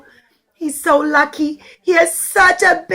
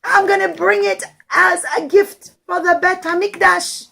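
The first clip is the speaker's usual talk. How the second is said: heartfelt prayer; 6 semitones higher, 4 dB louder than usual. Acted. Poor man's heartfelt prayer.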